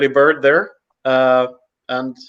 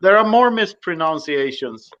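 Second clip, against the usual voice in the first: ordinary talk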